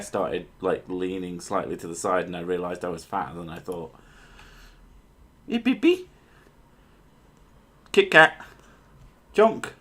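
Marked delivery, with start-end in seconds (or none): 5.5-9.7 high-pitched pet-calling voice; high little calls to a pet; calling the cat. Real, not acted. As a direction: coax the cat over, pitched way up.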